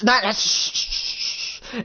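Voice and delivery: drawn-out hushed shushing, high; slow shushing whisper. Retold or imitated. Connor shushing Nathan right up in his face, slow whisper.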